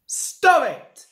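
sharp, high, loud interruption; snapped. A narrator's reading. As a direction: cut him off with a snap. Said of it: "snapped the signalman".

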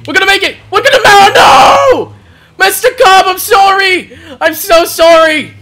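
high, loud cry of dismay; high-pitched anguished gamer yelling; panicked wail. Real, not acted. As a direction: wail out a desperate apology. Misses, apologises to teammate Cobb.

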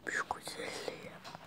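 hushed admiring whisper. Real, not acted. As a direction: whisper so the bird stays calm. Admiring the parrot up close. (hayranlıkla fısıldıyor)

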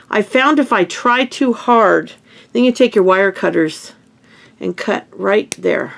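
usual speaking voice

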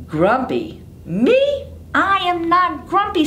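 squeaky on me, then denying; a grumpy monkey insisting he's fine; high indignant denial; indignant Jim. Acted. Jim protests, jumping.

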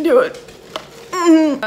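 humming while drinking; talking right after swallowing; sipping through straw. Real, not acted. Seen 0: Straw at lips through 0.4, then cup lowered and speaking.

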